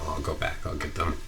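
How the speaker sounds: quiet calm wife taking over; wife's reply. Retold or imitated. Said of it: "She said".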